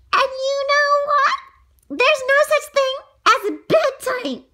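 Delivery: excited. Acted. Child announcing best rule.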